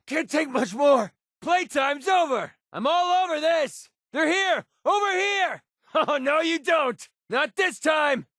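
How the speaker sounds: high yelled battle calls; combat shouts. Acted. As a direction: shout over gunfire. Companion combat barks, shouting.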